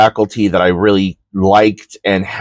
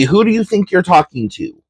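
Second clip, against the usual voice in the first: raised, quick heated comeback; faster, higher angry retort; retold defiance; 10 semitones higher, faster than usual. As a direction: turn his own question back on him. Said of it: "And I said".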